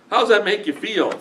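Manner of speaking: usual speaking voice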